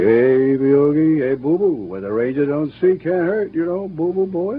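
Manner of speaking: put-on sing-out of a con bear; Yogi. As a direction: play the brash bear coaxing his sidekick. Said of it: "And he'd say".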